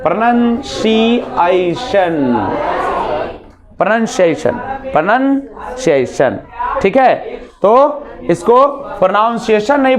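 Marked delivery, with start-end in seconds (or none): 0.0-6.4 "प्रोनंसिएशन" said slowly with "nun", not "noun"; teacher repeating "प्रोनंसिएशन" for students; correct pronunciation drill. Shown as a demonstration. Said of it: "प्रोनंसिएशन बोलते हैं".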